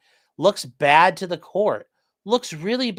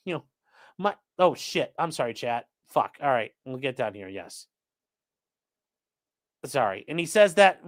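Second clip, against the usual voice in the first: usual speaking voice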